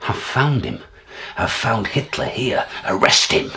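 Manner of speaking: loud, high shout, building to a bellow; yelling. Acted. Man announcing he has found Hitler, urgent yelling. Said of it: "and I yell".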